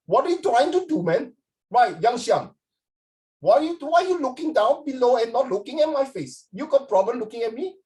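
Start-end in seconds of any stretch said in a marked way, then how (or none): 0.1-1.3 high mock-challenge in an Indian accent; offended Indian accent. Acting the offended Indian man. 3.4-7.8 high, mock-aggressive Indian accent; confrontational Indian accent.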